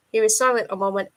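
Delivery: usual speaking voice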